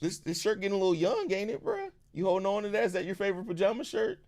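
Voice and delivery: soft, playful teasing; hushed fatherly ribbing. Real, not acted. Teasing son about his shirt.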